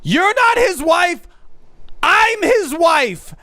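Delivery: high, outraged phone scream; stretched-out, high-pitched indignant yell; mock screaming. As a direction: mimic a woman screaming down the phone. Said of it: "began screaming".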